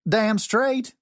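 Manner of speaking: high, emphatic Southern twang; Southern accent. A narrator's reading. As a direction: affirm it proudly in a Southern accent. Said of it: "the southern young man said".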